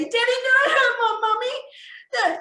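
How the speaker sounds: put-on high, slow, loud child's wail; acted crying kid's plea; pleading child. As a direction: plead tearfully like a small child. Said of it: "And they're saying to, to their daddy".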